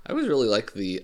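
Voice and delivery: usual speaking voice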